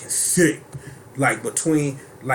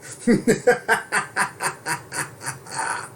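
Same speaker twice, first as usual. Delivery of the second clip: evil cackle; 7 semitones higher than usual. Pretend. Evil laugh after move.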